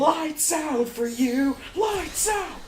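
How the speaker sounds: high, drawn-out mocking cry; mock echo. Player echoing the game villain's threat, mocking and shrill-high. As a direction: parrot the line way up high, stretched out.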